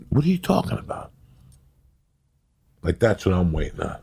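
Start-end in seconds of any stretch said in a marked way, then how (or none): 0.1-1.1 high, quick disbelieving retort; acted incredulity. Waker dismisses the man's dream, high and incredulous. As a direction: throw it back, pitched way up.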